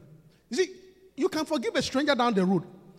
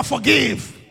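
shouted forgive; 2 semitones lower, 8 dB louder than usual. Shown as a demonstration. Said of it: "Tell your neighbor".

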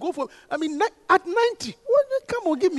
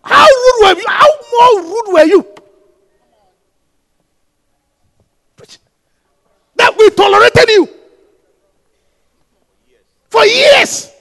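shouted rebuke; 8 semitones higher, 13 dB louder, slower than usual. Real, not acted. Preacher rebuking congregation.

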